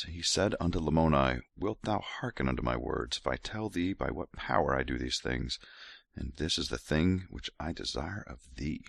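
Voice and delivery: soft, seductive scripture reading; sexy voice. Acted. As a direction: murmur each line like a lover's offer. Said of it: "gonna keep going with sexy voice".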